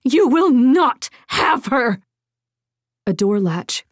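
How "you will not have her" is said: high, slow, desperate cry; restrained protective scream; defiant scream. A narrator's reading. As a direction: hammer out each word in defiance. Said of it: "the woman screamed".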